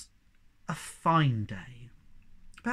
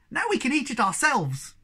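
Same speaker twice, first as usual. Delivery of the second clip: high squeaky Sniff voice; 10 semitones higher, 4 dB louder than usual. Acted. Sniff character voice in story.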